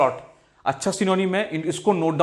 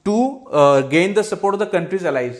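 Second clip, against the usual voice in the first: slow example reading; 3 dB louder, much slower than usual. Shown as a demonstration. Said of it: "usage में भी हम लोग".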